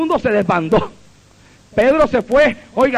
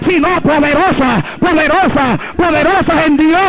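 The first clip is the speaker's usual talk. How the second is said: raised-pitch, driving pulpit cadence; fervent preaching; 5 semitones higher than usual. Real, not acted. Preacher declares spiritual weapons to the brethren, fervent preaching. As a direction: proclaim scripture insistently, phrase by phrase.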